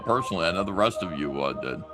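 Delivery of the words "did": ordinary talk, nothing marked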